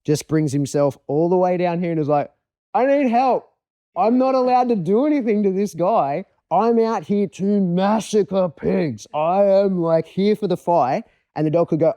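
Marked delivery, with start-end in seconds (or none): 2.7-11.0 high, loud pleading dog's voice; voiced dog pleading. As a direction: play the dog begging for help, pitched way up.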